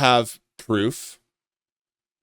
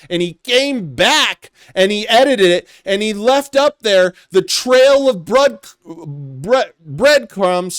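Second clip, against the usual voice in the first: higher and louder, getting heated; exasperated, heated rant; worked-up rant; 7 semitones higher, 5 dB louder than usual. Real, not acted. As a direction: build outrage as you pile up the evidence. Worked-up rant about the edited post.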